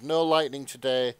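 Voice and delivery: ordinary talk, nothing marked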